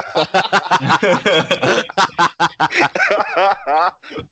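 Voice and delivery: laughing. Real, not acted. Laughing at own slip.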